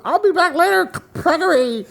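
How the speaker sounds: very high-pitched character yell; yelled down a hole. Acted. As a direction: call down into a tunnel in a squeaky high voice. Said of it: "I yell down into it".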